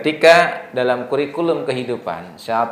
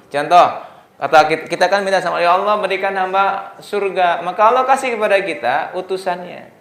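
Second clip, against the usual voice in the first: louder and higher, building conviction; rising sermon; 8 semitones higher, 5 dB louder than usual. Acted. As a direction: lift the sermon up with fervour. Preaching rises in force.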